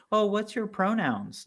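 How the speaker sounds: high, soft mimicry; mock politeness. Podcaster mimicking schools asking about pronouns, mock politeness. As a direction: imitate an overly careful school official.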